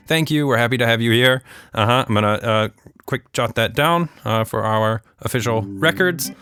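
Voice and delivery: usual speaking voice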